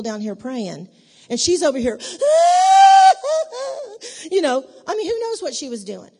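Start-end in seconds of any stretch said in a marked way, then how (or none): 2.0-4.2 loud, high, drawn-out wail; hammed-up drama-queen sobbing; mock wailing. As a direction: bawl at full volume, long and high. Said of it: "in there crying".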